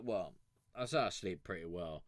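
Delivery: ordinary talk, nothing marked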